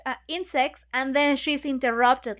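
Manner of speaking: usual speaking voice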